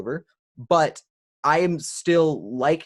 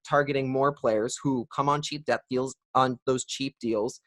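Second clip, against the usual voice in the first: ordinary talk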